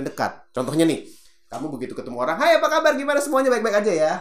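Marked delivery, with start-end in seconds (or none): 2.4-4.1 high, loud rushed hello; overeager fast greeting. Shown as a demonstration. Bad example of a speaker greeting someone too quickly, high and fast. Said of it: "terlalu cepat tuh merubah kecepatan".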